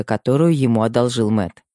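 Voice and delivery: ordinary delivery, nothing marked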